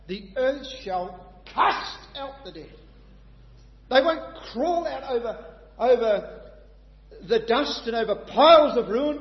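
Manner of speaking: loud, high preaching, building with fervour; full-force preaching. Acted. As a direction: preach it out to the hall with conviction.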